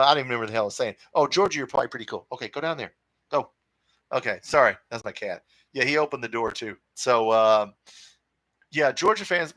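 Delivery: ordinary talk, nothing marked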